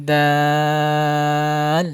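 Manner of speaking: "দাল" with a very long, level aa; four-alif elongation. Shown as a demonstration. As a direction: hold the vowel of "দাল" steadily, tongue light on the d. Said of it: "চার আলিফ পরিমাণ টেনে পড়বেন". ("দাল" চার আলিফ টেনে)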